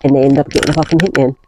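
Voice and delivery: usual speaking voice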